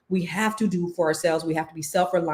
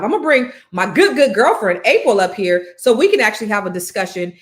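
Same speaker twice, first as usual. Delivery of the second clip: loud, higher-pitched stage welcome; rousing guest introduction; 3 semitones higher, 7 dB louder than usual. Real, not acted. Speaker calling a good girlfriend up for a discussion, rousing introduction. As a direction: bring a friend on with raised, lifted voice.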